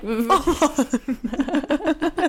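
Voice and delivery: high bursting laugh. Real, not acted. Lacht über harten Teig. (hohes Auflachen)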